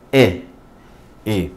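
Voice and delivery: E as a short open "eh"; short E sound. Shown as a demonstration. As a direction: isolate the vowel E, said as Spanish "e". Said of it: "tenga el sonido short". (E corta, como la e española)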